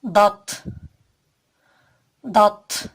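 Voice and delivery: "Dat" as a thick emphatic d, loud; Arabic letter dad. Shown as a demonstration. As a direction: sound "dat" from the side of the tongue. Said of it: "Kalın, yumuşak ve aşikar okunur". (kalın ve aşikar "dat")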